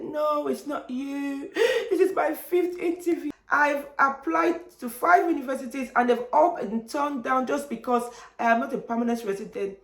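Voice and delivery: high, wailing mock sob; tearful, high-pitched retold wail; imitated crying. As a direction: act out breaking down mid-plea. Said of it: "I just broke down and started crying".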